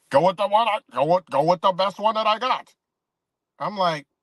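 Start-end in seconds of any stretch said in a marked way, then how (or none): 0.1-2.7 higher, louder caricature of a tipster; hammed-up impression, stumbling into the boast; mocking put-on voice. Vlogger imitating the sports picker's advice, mocking.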